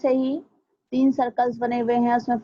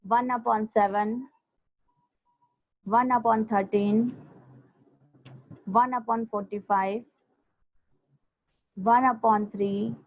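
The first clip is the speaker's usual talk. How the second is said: slow, with long pauses between items; slow dictation; much slower than usual. Shown as a demonstration. Teacher repeats unit fractions for students, speaking slowly. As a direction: dictate each fraction slowly, leaving time to write. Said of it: "मैं slow बोल रही हूँ थोड़ा".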